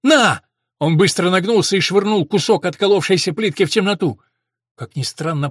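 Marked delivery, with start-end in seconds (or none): 0.0-0.4 high, loud burst; sudden forceful yell; calling out. A narrator's reading. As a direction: shout it abruptly. Den throws a decoy, calling out.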